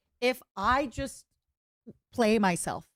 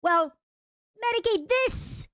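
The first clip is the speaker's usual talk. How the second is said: much higher taunting villain; 13 semitones higher than usual. Acted. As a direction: sneer it up high.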